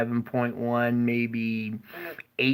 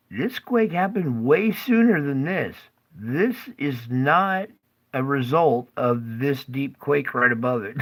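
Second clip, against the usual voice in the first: normal talking voice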